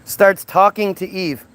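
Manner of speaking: normal talking voice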